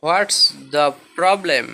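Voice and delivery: slow, deliberate English question; slow model question; slow teaching model. Shown as a demonstration. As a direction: ask it slowly for students to copy. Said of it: "কি সমস্যা".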